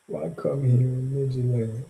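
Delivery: ordinary delivery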